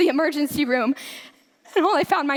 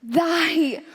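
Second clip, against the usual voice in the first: whispered prayer; 4 semitones lower, much slower than usual. Acted. Sister's whispered prayer remembered.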